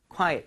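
"quiet" as "kwai-et"; two-syllable quiet. Shown as a demonstration. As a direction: give "quiet" its second vowel. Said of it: "조용한이라고 할 때는 quiet". ("quiet"를 '콰이-엇'으로 또렷이 나눠 발음)

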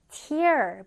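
"tear" said like "tier", with an ee sound; noun pronunciation. Shown as a demonstration. Teacher shows students the noun, "tear" pronounced like "tier". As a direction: say "tear" to rhyme with "ear". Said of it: "명사 형태 눈물을 써주는".

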